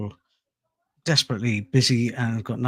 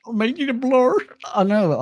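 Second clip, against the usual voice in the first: put-on southern accent; 10 semitones higher, 4 dB louder than usual. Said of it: "practicing my southern accent for you".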